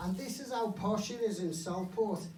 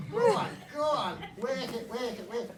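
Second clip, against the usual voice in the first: porn voice; 4 semitones higher, 4 dB louder, faster than usual. Retold or imitated.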